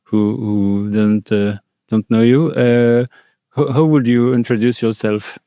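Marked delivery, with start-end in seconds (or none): none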